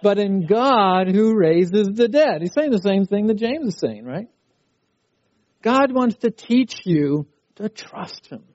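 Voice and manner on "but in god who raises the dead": slow, emphatic group reading; congregational recitation. Real, not acted. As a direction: lead the congregation in reciting the verse. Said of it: "let's say it together".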